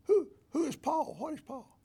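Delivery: high, fast, baffled questions; imitating puzzled lady. Lady in his study asking who Paul is, high baffled voice.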